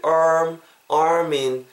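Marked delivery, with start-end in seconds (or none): 0.1-0.6 "arm" with the R held and clearly coloured; clear R sound. Shown as a demonstration. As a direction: stretch the R in "arm". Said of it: "arm 할 때 R 사운드".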